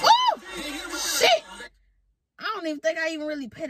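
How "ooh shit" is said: startled high-pitched exclamation; shocked excitement. Real, not acted. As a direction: react to a sudden big hit. Reacting to a hit on screen.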